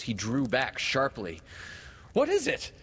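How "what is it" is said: very high, loud outcry; alarmed cry. A narrator's reading. Peter draws back from the grown Wendy, crying out. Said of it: "he cried again".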